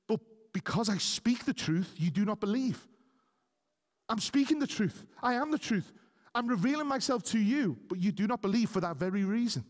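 high-pitched, insistent preacher delivery; forceful preaching. Acted. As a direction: preach it with rising urgency, pitched well above normal talk.

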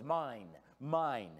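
lightly voiced possessive toddler; a hint of a greedy two-year-old; toddler grabbing toys.

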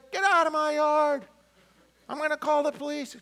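put-on high, loud old man shouting; cranky neighbor yelling. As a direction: chase the kid off the lawn.

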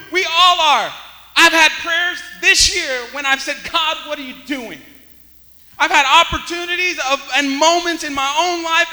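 loud, high, impassioned sermon; full-force preaching. Real, not acted. Preacher confessing his own protests to God, full-force preaching. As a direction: cry it out to God and congregation.

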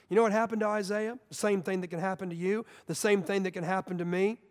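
ordinary talk, nothing marked

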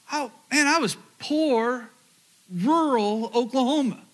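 sincere, near tears remembering poverty; voice breaking. Real, not acted. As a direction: let emotion crack through the telling.

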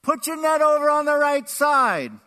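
high, loud holler across water; big, carrying yell; calling out from shore. Acted. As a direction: shout instructions out to a boat. Man on shore calling to boat.

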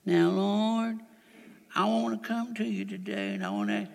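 soft, hesitant little prayer; timid prayer. Pretend. A believer praying timidly to the Lord, soft and hesitant. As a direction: mumble a shy prayer to the Lord.

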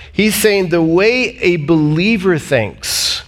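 ordinary talk, nothing marked

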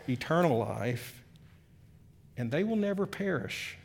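slow, soft and low, word by word; hushed scripture reading. Acted. Preacher quoting Jesus' promise to the flock, slow and hushed. Reads scripture slowly and softly.

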